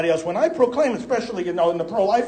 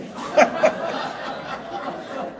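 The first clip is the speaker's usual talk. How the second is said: airy laugh; 6 semitones higher, 6 dB quieter than usual. Real, not acted. Laughing at Helms's punchline.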